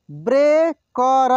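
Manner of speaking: Breaker drawn out slowly, syllable by syllable; slow sounding out. Shown as a demonstration. Teacher slowly sounds out breaker for students. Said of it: "एक एक मात्रा को अलग अलग करके".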